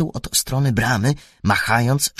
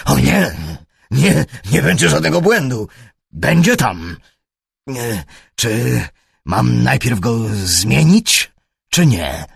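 louder, high, eager to please; anxious pleading; 4 semitones higher, 7 dB louder than usual. A narrator's reading. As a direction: scramble to reassure. Przestraszony trener zapewnia Holmesa. (przestraszony trener gorliwie zapewnia)

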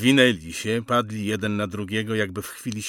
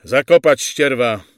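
loud, high military command; sergeant's contemptuous shout; barked order; 5 semitones higher, 13 dB louder than usual. A narrator's reading. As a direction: order the men roughly to bury them. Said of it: "rozkazał sierżant".

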